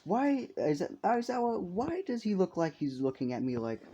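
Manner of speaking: high-pitched, slightly affronted questioning; mildly offended. Acted. Nezu asks Aizawa about the guest's stare, mildly offended. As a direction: ask it high, a touch put out. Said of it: "he feels a bit offended".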